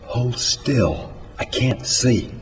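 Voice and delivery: soft, hushed voice; whispered. A narrator's reading. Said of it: "John whispers".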